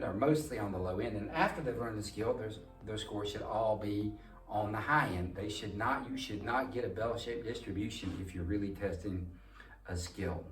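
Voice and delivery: usual speaking voice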